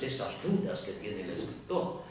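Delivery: usual speaking voice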